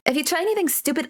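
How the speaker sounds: rushed, warning tone; quick threat. Acted. Tutor warning the guest, rushed threat. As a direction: rattle off a threat fast.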